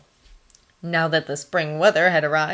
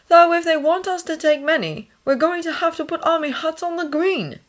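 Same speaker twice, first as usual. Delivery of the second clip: lightly voiced higher-pitched character; Thomas in conversation; 11 semitones higher than usual. A narrator's reading. Thomas talking hospital plans with the nurse, conversational. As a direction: lift the voice for his dialogue.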